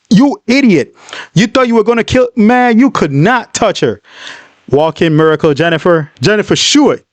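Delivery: usual speaking voice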